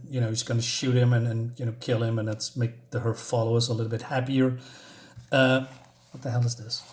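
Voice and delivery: normal talking voice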